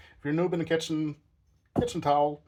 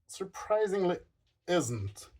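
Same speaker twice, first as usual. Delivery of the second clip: ordinary talk, nothing marked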